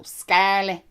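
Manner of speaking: broad Dublin 'Scarlet' with the final T dropped; thick Dublin accent. Shown as a demonstration. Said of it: "you don't pronounce the T".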